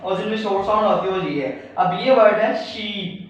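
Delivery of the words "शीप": शीप with a long drawn-out ee; long vowel. Shown as a demonstration. Teacher modelling the long vowel in शीप for students. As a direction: stretch the ee in शीप slowly. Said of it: "यहाँ पे इस चीज़ पे stress दिया गया".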